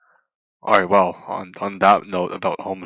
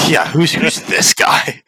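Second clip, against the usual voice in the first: higher, slow, breathy mock question; mock suspicious echo; 9 semitones higher, 3 dB louder, slower than usual. Real, not acted. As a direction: play along with the joke on yourself. Guest echoing the teasing.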